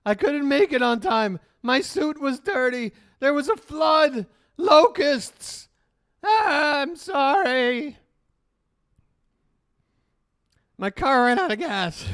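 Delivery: high, loud, panicky pleading; hammed-up Belushi excuse voice, high and loud; frantic excuses. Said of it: "where she's like".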